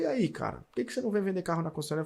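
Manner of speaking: a casual, upbeat invitation, a hint of the manager; friendly manager. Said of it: "o gerente falou".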